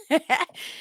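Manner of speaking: high bursting laugh at herself; real laugh. Real, not acted. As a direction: laugh it off after getting emotional. Laughs at own emotional moment.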